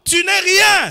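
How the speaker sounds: ordinary delivery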